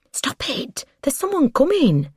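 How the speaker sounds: hushed, then high urgent warning. A narrator's reading. Woman warns amorous partner of a passer-by, urgent. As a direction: start hushed and jump high in alarm.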